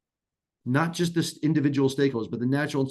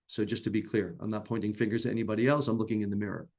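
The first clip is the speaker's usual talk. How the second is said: humble quiet aside; 5 semitones lower, 7 dB quieter, faster than usual. Real, not acted. Humble aside about himself.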